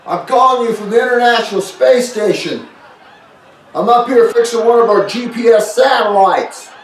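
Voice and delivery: high, distant radio call from orbit; breaking up space radio. Pretend. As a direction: crackly call from space, playing it up. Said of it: "Just kidding. I'm not actually in space".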